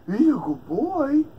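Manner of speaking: high, slow cooing; baby talk to pet. Acted. Owner cooing praise at his hamster, high and slow. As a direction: coo it like talking to a puppy.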